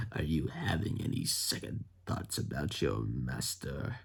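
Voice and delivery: low, soft villain impression; Palpatine. Emperor questioning Vader about his master, imitated villain voice. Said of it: "Palpatine shows up in this series".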